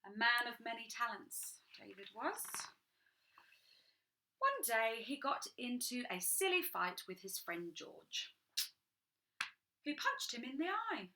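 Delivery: ordinary delivery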